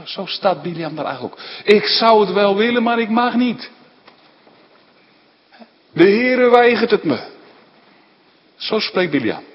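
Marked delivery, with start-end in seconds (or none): 1.6-7.2 put-on sulky child. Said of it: "verongelijkt kind".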